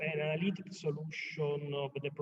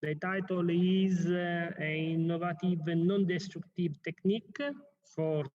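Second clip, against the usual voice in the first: loud, slow conference introduction; formal announcement; 3 semitones higher, 4 dB louder than usual. Acted. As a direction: announce the next speaker formally. Chair formally announces next talk.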